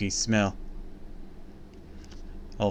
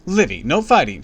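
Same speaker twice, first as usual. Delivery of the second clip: quick sharp rebuke, louder and higher; snapping; 6 semitones higher, 8 dB louder than usual. A narrator's reading. Mom snapping at daughter over the Pop-Tart, sharp. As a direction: cut the fight short. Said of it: "she snapped".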